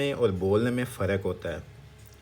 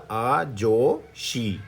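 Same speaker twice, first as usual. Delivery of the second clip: slowly; much slower than usual. Shown as a demonstration. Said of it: "तो तेज़ी से क्या पढ़ेंगे".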